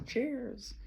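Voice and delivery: high, bright party toast; cheery toast. Pretend. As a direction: raise a glass and call it out.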